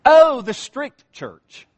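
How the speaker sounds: hammed-up waitress loudly calling it the strict church. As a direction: announce it across the diner. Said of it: "She raises her voice again".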